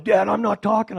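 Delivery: high, loud panicky refusal; nervous son. Retold or imitated. Son telling father before the festival, high and panicked. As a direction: blurt it out in a panic. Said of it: "my son John goes".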